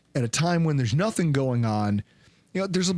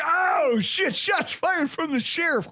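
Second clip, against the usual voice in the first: higher, shouted exclamation, slowing on the second line; mock shocked shout; 11 semitones higher, slower than usual. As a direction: yell it like you just got roasted. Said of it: "I was like".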